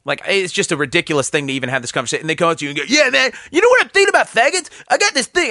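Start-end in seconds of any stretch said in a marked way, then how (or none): 2.9-5.5 high, loud, hammed-up voice of a bigoted fan; mock bigoted fan. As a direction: play the pushy fan pitching a joke, loud and high.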